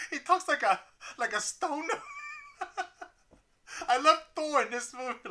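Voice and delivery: talking through laughter; giggling while speaking; laughing. Real, not acted. Laughing while describing Thor.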